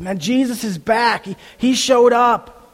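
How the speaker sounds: mock-excited friend insisting it's true; excited news. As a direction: burst out with the news that he's back. Disciples excitedly telling Thomas.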